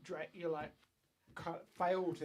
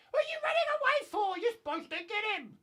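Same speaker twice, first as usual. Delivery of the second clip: Drake's shout; 9 dB louder, faster than usual. Acted. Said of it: "Then Drake's like".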